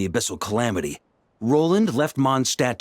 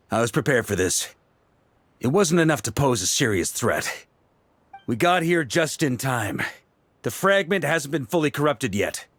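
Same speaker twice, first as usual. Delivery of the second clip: normal talking voice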